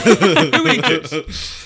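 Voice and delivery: high, slow question broken by laughter; laughing reread. Real, not acted. Host laughing at own wording, slow and high. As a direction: laugh through the reworded question. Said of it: "that was so badly worded".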